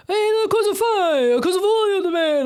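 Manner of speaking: hammed-up exasperated Italian; mimicked Italian rebuff. Mimics Italians answering her at doors.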